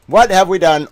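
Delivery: hammed-up wail at the world; dramatic loud lament. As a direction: cry it out loud in mock despair. Repeated dramatic lament, louder.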